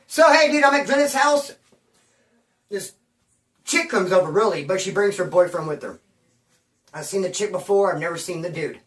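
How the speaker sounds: usual speaking voice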